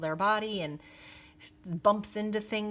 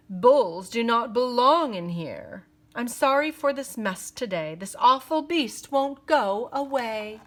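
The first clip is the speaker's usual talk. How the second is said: louder, haughty complaining clerk; sneering; 5 semitones higher, 6 dB louder than usual. Acted. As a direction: sneer at the awful beast. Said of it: "the clerk said with a sneer".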